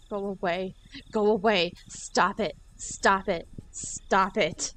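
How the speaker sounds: loud, forceful "stop it"; angry yell. Acted. Keiichi fighting the door.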